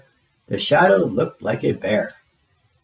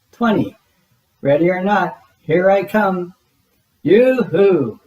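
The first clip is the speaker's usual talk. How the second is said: calling out; 5 semitones higher, 3 dB louder than usual. A narrator's reading. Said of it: "Big Bird called".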